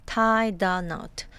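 tie the knot, drawn out word by word; slow model. Shown as a demonstration. Teacher modelling a new idiom, slow and measured tie the knot. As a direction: say tie the knot slowly for learners.

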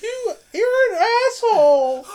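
high, loud put-on girl voice, laughing; mock outraged girl. Friend imitates a girl insulting the guy, high and loud. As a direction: squeal it as an offended girl. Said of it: "she was like".

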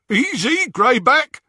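high, loud henchman's call; calling over jeering. A narrator's reading. Henchman checks the werewolf, calling over the jeering. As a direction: pipe up over the noise. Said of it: "over the jeering of the others".

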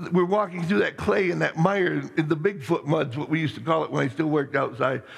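normal talking voice

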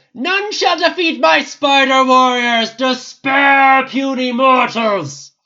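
dragon boss voice. Acted. The simulated dragon taunting the heroes in battle, booming creature voice.

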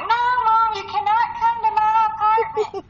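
high-pitched exclaimed protest to mom; mock-alarmed daughter squealing at mom; retold protest. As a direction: cry out no, voice jumping way up. Telling mom not to come.

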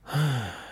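a long, heavy sigh. A narrator's reading.

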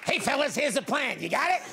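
high-pitched comic ringleader voice; gang leader. Acted. Acted gang leader briefing the crime.

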